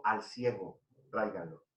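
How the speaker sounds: low, soft order; quiet retold command. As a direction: drop the voice for a calm, quoted command. Jesus's command quoted softly. (orden imitada en voz baja)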